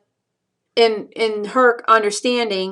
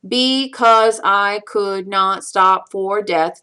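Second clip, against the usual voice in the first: "Because I could not stop for death" slow, one even beat per syllable; counting out syllables; much slower than usual. Shown as a demonstration. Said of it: "count out the syllables".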